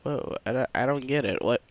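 ordinary talk

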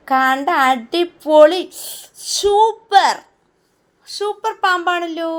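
high, loud, slowly stretched cheering; showing off the toddler's snake drawing with big, high cheers; gushing praise. Acted. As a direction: lay the wonder on thick for the child. Mother praising child's snake drawing.

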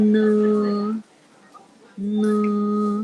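long, level "เนอ", a little through the nose; slightly nasal sound. Shown as a demonstration. Teacher models the nasal letter for pupils, drawn-out "เนอ". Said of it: "เสียงเนอนะคะ จะขึ้นจมูกนิดนึง".

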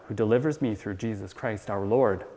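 usual speaking voice

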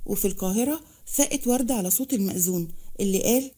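ordinary delivery, nothing marked

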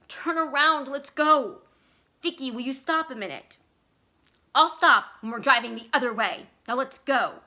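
wife demanding to turn around, loud and insistent; urgent demand. Acted. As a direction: order him to leave now. Vicky demanding they leave.

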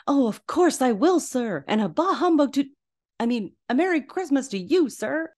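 high, bouncy, eager clerk; chipper Bob Cratchit. Retold or imitated. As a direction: imitate an over-cheerful employee, pitched well up. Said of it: "Chipper Bob Cratchit is like".

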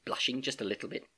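ordinary delivery

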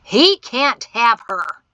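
loud, drawn-out angry boom; booming shout. A narrator's reading. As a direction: boom it out, stretching each word. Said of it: "A booming voice on the other side of the door".

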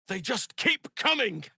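high urgent combat shout; alarmed call to the party; battle cry. Acted. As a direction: yell it over the fighting.

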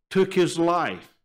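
ordinary talk, nothing marked

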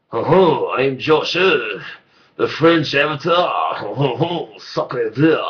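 put-on loud, hammy French accent; french chef. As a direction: belt it like a cartoon French chef. French Avatar character voice.

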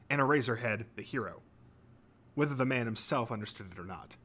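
plain reading voice

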